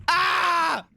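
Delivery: a startled high shout; sudden cry. Real, not acted. Sudden cry while playing.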